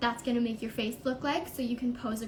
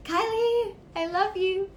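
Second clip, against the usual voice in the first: excited call; 8 semitones higher, 5 dB louder, slower than usual. Real, not acted. Calling out to a friend.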